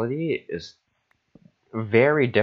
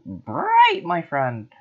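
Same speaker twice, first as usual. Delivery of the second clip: surprised squeak; 8 semitones higher, much slower than usual. Real, not acted. Sudden high exclaim.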